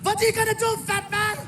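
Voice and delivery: German heckler. Retold or imitated. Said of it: "he freaking did it".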